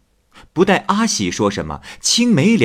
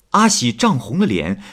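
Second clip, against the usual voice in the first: plain reading voice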